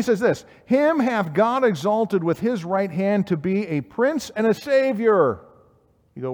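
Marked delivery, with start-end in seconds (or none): 0.7-5.4 slow, raised preaching voice; scripture proclamation. Acted. Preacher quoting Peter's message to the congregation, proclaiming. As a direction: proclaim it higher, drawing the words out.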